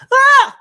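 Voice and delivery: very high, loud delighted shriek; joyful scream. Acted. Wife shrieking with joy when pinned playfully. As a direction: squeal with joy as he pins her. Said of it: "She screams with joy".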